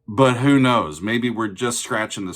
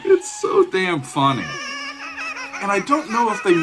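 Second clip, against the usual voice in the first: laughing; 10 semitones higher than usual. Real, not acted. Cracking up at an odd song.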